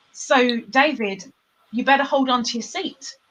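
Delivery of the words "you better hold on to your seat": usual speaking voice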